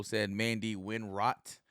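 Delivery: ordinary delivery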